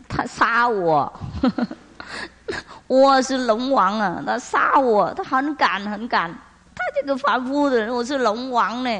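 high, tearful complaining wail; a dragon king sobbing to God, hammed up; whiny crying dragon king. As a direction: whimper out the grievance like a wronged child. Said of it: "在那里哭闹".

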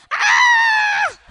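piercing high parrot screech; imitated bird scream. Bird ignored by owner, shrill screamed imitation. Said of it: "And then scream".

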